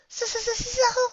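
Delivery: very high, stammering, frightened; quavering. Acted. Fluttershy quavers in fear about the dragon, very high. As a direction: stutter in terror. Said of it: "she quavered".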